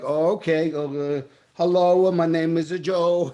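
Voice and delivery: hammed-up Italian accent, loud and drawn out; mock Italian accent. Unprepared actor auditioning in Italy, hammed-up slow Italian accent. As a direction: slowly overdo a clumsy Italian accent. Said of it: "I would have never had that confidence".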